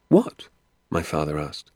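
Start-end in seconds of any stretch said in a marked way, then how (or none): 0.1-0.5 high, loud one-word question; eager surprise. Acted. Father asking doctor about Hazel's filthy deed, eagerly. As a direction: pounce on it, wanting the story.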